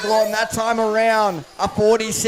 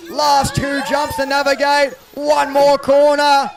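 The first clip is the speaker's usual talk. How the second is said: building excitement; 5 semitones higher, 5 dB louder than usual. Real, not acted. Final corner of the race.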